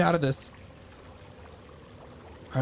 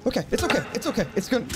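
high, nervous repeating; panicky self-reassurance; 6 semitones higher, much faster than usual. Real, not acted. Player calming self under attack, panicky self-reassurance. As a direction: talk yourself down in a panic.

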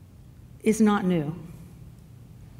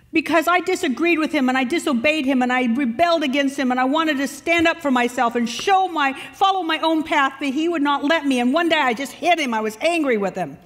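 raised, higher and louder, pouring out grievance; a young man venting rage at his father; hammed-up anger, loud and high; angry outburst; 7 semitones higher, 5 dB louder than usual. Son's angry account of father.

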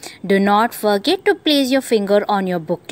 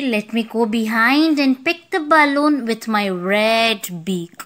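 ordinary delivery

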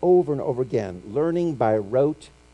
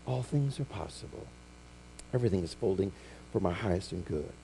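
serene reply; 8 semitones lower, 12 dB quieter than usual. Pretend. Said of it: "this wonderful response".

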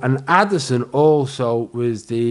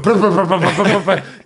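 loud blubbering "bruh" noises with a laugh, higher; mock raspberry put-down; 6 semitones higher, 4 dB louder, faster than usual. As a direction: blow a silly lip-trill noise at the heckler. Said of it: "I went".

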